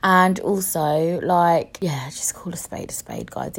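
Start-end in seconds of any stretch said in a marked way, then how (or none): 1.8-3.6 breathy confiding whisper. Real, not acted. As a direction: lean in and whisper it like a secret. Confiding aside to viewers.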